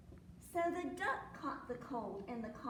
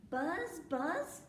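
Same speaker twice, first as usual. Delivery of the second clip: loud, raised buzz, swooping upward; cow buzzing; 5 semitones higher, 5 dB louder than usual. A narrator's reading. Storyteller as the cow who caught the buzz, loud buzzing. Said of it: "said the cow".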